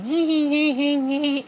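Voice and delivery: high-pitched imitated girly titter; mock squeaky giggle. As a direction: mimic an irritating squeal of laughter. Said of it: "You, you sound like that".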